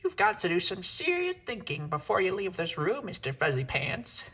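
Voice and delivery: high mother-cat voice, lecturing; lecturing mama. Acted. Mother cat lecturing her son, high stern voice. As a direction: lay down the law like a stern mom. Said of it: "said his mother".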